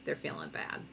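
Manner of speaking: soft, low mock pity; play-acted little pang of guilt; sad, guilty. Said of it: "little sad ears".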